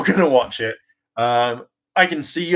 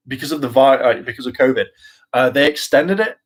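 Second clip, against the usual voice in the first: normal talking voice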